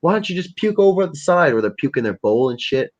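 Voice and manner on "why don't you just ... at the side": higher, exasperated scolding of the cats; pitched-up fed-up cat owner; mock scolding cats. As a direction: curse them out in a pitched-up voice. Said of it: "I'm like".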